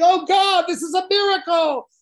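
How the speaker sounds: pious awe. Retold or imitated. Pious grandparent on their knees before the bush, awed cry. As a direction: gasp it out like a devout believer.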